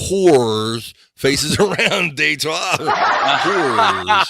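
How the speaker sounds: drawn-out word through laughter; laughing. Real, not acted. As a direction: stretch it out, cracking up.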